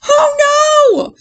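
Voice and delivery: very high, loud scream; children's-story scream of alarm. Acted. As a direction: scream it in panic. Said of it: "screamed Bear and Mole".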